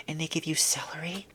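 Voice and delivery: hushed scandalised whisper. Real, not acted. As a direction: lean in and whisper it. Whispered punchline.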